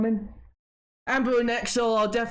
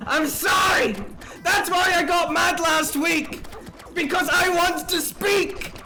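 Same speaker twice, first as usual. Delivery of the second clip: angry rant; 7 semitones higher than usual. Real, not acted. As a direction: blow up at friends who keep talking over you. Real rant about being talked over.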